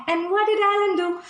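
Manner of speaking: usual speaking voice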